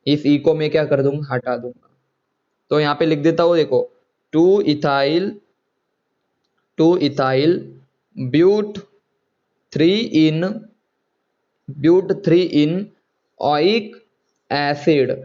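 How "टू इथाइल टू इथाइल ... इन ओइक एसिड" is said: slow dictation with pauses between name parts. Real, not acted. Teacher writes out the compound name for students, dictating slowly. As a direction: spell it out slowly, piece by piece.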